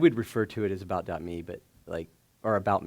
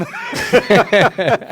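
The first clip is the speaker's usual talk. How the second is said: high, loud laughter; 13 semitones higher, 16 dB louder than usual. Real, not acted. Remembering asking for the dot com, laughing loudly. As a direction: laugh out hard.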